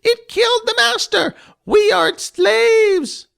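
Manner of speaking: high, loud panicked goblin shouting, last line slow; frightened goblins crying out in alarm; goblin uproar. Acted. The goblins shout in uproar against Jess. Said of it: "There was an uproar".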